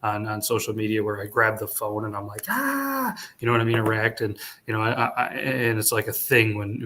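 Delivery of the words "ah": high, drawn-out "ah" at moderate volume; imitated high wail of horror; mock scream. Acts out his video reaction.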